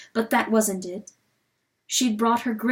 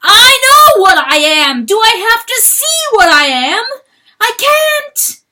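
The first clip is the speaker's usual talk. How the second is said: much higher and louder, breaking into a cry; crying bellow; 12 semitones higher, 13 dB louder than usual. Acted. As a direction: roar it out through sobs, voice cracking. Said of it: "bellowed straight into the ground".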